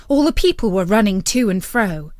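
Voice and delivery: plain reading voice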